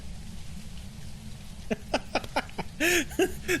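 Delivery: high breathless laugh. Real, not acted. Cracks up at mispronunciation.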